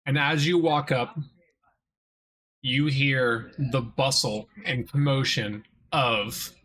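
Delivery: slow, even scene-setting narration; game master narration. A narrator's reading. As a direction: paint the scene slowly for the players.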